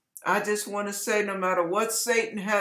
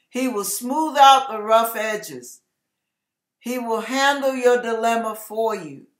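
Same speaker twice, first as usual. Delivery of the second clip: louder, driving sermon delivery; fervent preaching; 3 semitones higher, 7 dB louder than usual. Acted. Pastor exhorting viewers to stop controlling, preaching loudly. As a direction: press each command home like a preacher.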